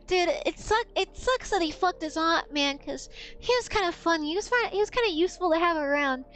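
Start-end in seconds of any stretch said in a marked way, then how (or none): none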